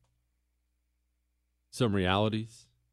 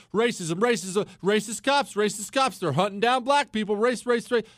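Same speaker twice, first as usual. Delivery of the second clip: mocking propaganda; 6 semitones higher than usual. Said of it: "propaganda you see in the news".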